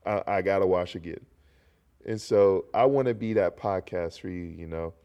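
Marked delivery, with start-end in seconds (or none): 0.0-1.2 mock disgust. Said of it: "And I'm just like".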